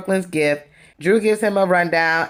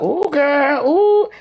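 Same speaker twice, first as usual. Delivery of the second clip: high, loud mock "ooh girl" squeal; messy gossip squeal; 10 semitones higher, 4 dB louder, much slower than usual. The host imitating Anthony hearing about Sheree's shade, high gossip squeal. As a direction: squeal it like a messy friend hungry for drama. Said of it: "Anthony was like".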